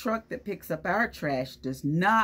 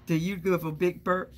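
usual speaking voice

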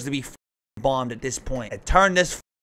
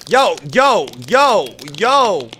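alarmed calling out; 6 dB louder, much slower than usual. Real, not acted.

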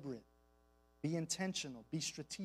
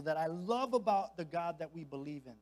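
normal talking voice

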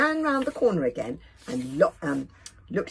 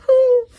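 very high, loud strained cry; straining groan; 17 dB louder than usual. A narrator's reading. Dragon bursting to speak with mouth full, straining cry. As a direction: force it out as if bursting. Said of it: "red in the face".

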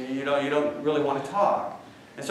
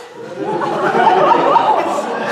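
big laugh; 5 dB louder than usual. Real, not acted. Audience reaction to punchline.

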